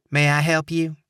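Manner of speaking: sweet-as-pie Southern receptionist greeting; saccharine Southern accent. A narrator's reading. Receptionist greets visitors, saccharine Southern accent. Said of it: "she asked in a saccharine Southern accent".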